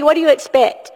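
ordinary talk, nothing marked

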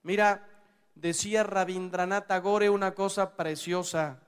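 usual speaking voice

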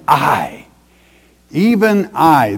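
"I, even I" with both I's punched; emphatic. Acted. Preacher voicing God's own declaration, emphatic. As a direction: hit each "I" hard, pausing between them. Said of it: "that's emphatic".

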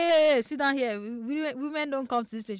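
mildly mocking order to sit down; bossy rebuke. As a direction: put someone in their place, acted. Voices those who silence women.

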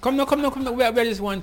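high, loud rush to hurry someone along; urgent hurrying. Real, not acted. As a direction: hurry your partner up, pitched high and loud. Urging partner as race nears start.

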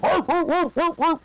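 high mock barking woof woof; imitated dog barking. Mocking police dogs at the courthouse, high barking.

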